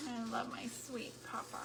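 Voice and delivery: soft whispered words about her grandpa. Real, not acted. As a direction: breathe it out tenderly. Tender remembrance of grandpa.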